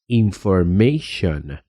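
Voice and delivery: information said slowly, ending in shon; slow model of information; English pronunciation. Shown as a demonstration. Teacher shows the tion ending to learners, slowly. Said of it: "se pronuncia".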